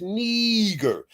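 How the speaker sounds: long, stretched ee in "Niger"; corrected pronunciation. Shown as a demonstration. Host explains the one G, "Niger" drawn out as NEE-ger. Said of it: "with one G, it's Niger".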